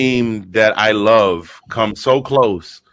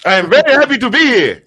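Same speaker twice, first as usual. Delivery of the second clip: mock grand greeting; 12 semitones higher, 4 dB louder than usual. Guest greeted on show, mock formal.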